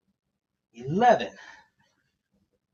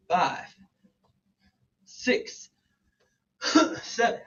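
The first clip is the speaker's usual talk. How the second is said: ordinary delivery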